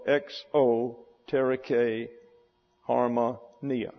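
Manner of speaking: slow "exoteric harmonia", eks, oh, teh-ree-KAY, har-ma, NEE-a; syllable-by-syllable pronunciation. Shown as a demonstration. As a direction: break "exoteric harmonia" slowly into pieces with pauses. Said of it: "Same as above".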